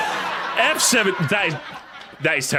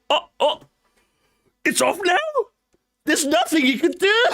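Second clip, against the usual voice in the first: mock innocent self; 11 semitones higher than usual. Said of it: "I just go".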